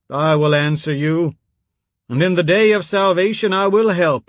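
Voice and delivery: put-on old priest booming scripture in a cell; Sunday sermon baritone. A narrator's reading. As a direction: read scripture like a preacher filling a church. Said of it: "with the baritone of a Sunday, he read".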